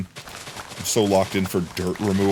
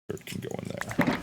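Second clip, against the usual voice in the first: whisper; 12 dB quieter, much faster than usual. Pretend. Whispered repeat.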